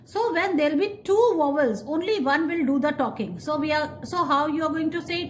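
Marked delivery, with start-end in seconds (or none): none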